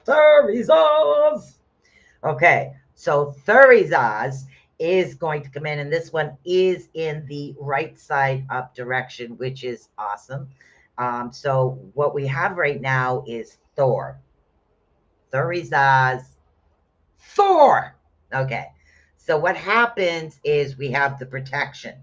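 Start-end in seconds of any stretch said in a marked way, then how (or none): 0.1-1.6 high, loud, slow announcement of Thurizaz; dramatic proclamation. Shown as a demonstration. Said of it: "Thurizaz is coming in". 17.2-18.0 high, loud cry of Thor; exultant hail. Acted. Hailing the god Thor.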